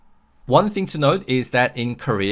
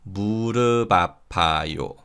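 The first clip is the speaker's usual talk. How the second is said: slow linked demo; 2 semitones lower than usual. Shown as a demonstration. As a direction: say 무릎 아파요 slowly as "mu-reu-ba-pa-yo". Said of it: "So this is read".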